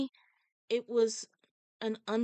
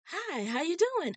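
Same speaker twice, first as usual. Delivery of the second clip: friendly greeting; 9 semitones higher than usual. Retold or imitated. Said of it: "she said".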